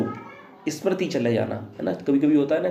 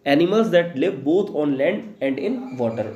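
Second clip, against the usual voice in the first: 1 slow dictation; much slower than usual. Shown as a demonstration. Teacher dictating English definition.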